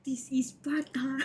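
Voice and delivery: slow breathy whispered joke line; mock dramatic whisper. Streamer joking about the chaos, a slow mock whisper. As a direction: whisper it like a dramatic movie quote. Joking aside after a gasp.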